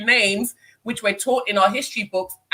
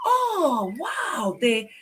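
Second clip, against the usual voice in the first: high, drawn-out mock admiration; put-on wonder in a high voice; imitated amazed praise; 8 semitones higher, slower than usual. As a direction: gush like an impressed elder, pitched way up. Mimics impressed people praising speech.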